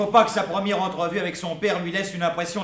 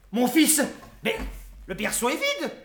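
shocked alarm; 10 semitones higher than usual. Acted. Father finds the cradle empty.

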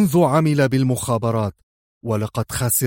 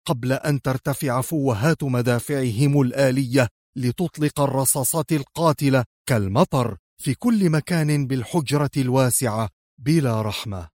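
ordinary delivery, nothing marked

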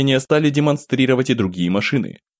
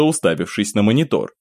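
plain reading voice

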